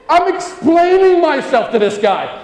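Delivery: laughing loud realization; self-aware outburst. Real, not acted. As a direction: catch yourself mid-pitch, amazed. Realizing he's been outplayed.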